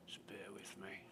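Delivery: hushed whisper aside. Real, not acted. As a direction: whisper it under your breath. Asks viewers to wait.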